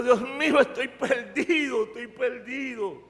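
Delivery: acted-out plea of a lost man; retold desperate prayer. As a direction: wail lightly to God, fading. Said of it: "empecé a llorar".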